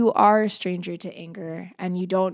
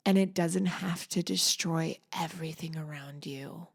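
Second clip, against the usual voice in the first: soft whisper; 7 dB quieter than usual. Real, not acted. Intimate closing thought on rage.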